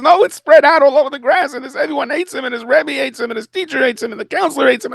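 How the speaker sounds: very high, wailing complaint; a boy listing everyone who hates him; whining kid. Retold or imitated. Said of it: "He says".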